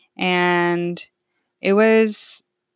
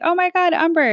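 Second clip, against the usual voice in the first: much higher, gushing hello; excited family greeting; 10 semitones higher, 3 dB louder than usual. Retold or imitated. Aunt and family welcoming Umber home, high and delighted. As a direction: cry her name in delight.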